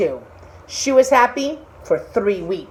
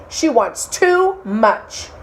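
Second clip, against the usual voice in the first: fish refuses; 4 semitones higher than usual. Acted.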